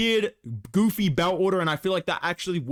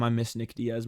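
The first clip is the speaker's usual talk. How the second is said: ordinary talk, nothing marked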